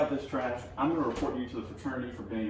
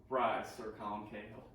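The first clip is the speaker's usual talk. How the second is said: solemn regal knighting; 5 semitones lower, 4 dB quieter than usual. Acted. Mock knighting ceremony skit.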